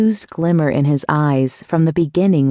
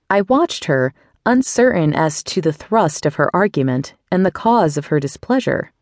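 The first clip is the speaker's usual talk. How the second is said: ordinary talk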